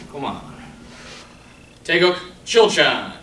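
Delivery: crisp called-out form commands; sharp martial arts commands. Shown as a demonstration. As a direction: call each move like a drill. Calls form movements over music.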